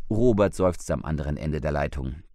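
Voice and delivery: ordinary delivery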